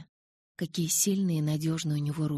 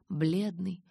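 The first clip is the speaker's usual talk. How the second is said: usual speaking voice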